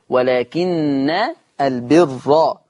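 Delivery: slow ولكن البر with doubled nun held; Hafs recitation. Shown as a demonstration. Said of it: "بفتح النون مع تشديدها".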